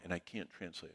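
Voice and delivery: whispered aside from the translator; breathy urgent whisper; hushed whisper. Retold or imitated. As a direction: whisper it so only the speaker hears.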